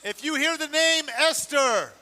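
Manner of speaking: usual speaking voice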